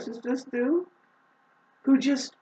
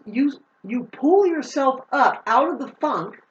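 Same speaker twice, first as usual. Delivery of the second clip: ordinary delivery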